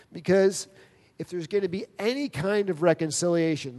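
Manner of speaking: usual speaking voice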